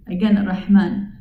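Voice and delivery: usual speaking voice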